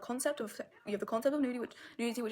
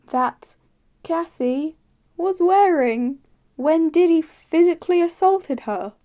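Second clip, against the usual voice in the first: outraged rant; 4 semitones higher, 5 dB louder, slower than usual. Real, not acted. Outraged build-up about [name] merch.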